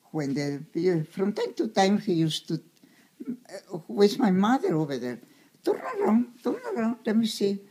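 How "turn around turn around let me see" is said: voicing the old doctor much higher, coaxing; a kindly doctor asking the little girl to turn, pitched way up. Old doctor checking the child at home, high coaxing voice.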